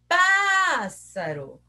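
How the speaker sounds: PÁSSARO with a long, high PÁÁÁ; calling the word. Shown as a demonstration. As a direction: call out PÁSSARO, holding the first syllable. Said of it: "Qual que eu falei mais?".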